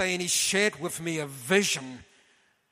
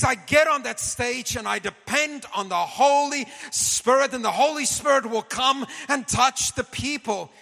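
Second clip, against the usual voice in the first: high, loud imitation of a fervent preacher; preaching voice; 7 semitones higher, 4 dB louder than usual. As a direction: retell the old evangelist's plea, raised and loud. Retelling Reinhard's preaching to him.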